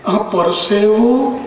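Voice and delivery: measured, playful verse reading, rising toward the end; comic poem recital. Acted. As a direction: savour each image like a stage poet. (હળવું હાસ્ય કાવ્ય પઠન)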